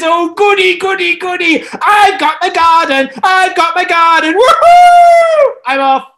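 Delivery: put-on very high, loud childish glee; delighted. Ahab gleeful at getting the vineyard, squealing. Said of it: "Ahab is delighted".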